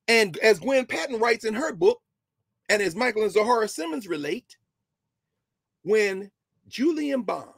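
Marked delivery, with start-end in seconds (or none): none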